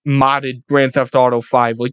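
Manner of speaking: usual speaking voice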